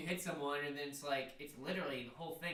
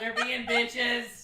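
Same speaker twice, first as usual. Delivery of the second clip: laughing; 8 semitones higher, 10 dB louder, much slower than usual. Real, not acted. Slow laughing complaint.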